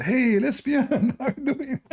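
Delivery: high-pitched, drawn-out greeting; calling out. Acted. Calling across the cafeteria.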